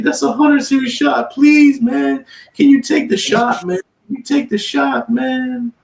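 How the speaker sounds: mock the crying cellmate begging high; imitating crying. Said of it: "He starts crying".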